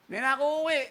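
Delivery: wife threatening. Retold or imitated. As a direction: storm off saying you won't return.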